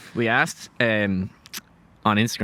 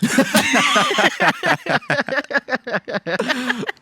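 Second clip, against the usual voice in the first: long loud laugh; 4 dB louder than usual. Real, not acted. Reacting to coconut joke.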